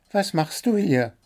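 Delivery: high, chirpy bird voice listing its complaints; little blue bird, demanding. A narrator's reading.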